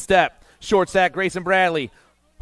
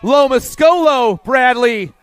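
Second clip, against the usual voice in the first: rising, louder finish call; excited race call; 4 semitones higher, 3 dB louder than usual. Real, not acted. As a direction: build to the finish line.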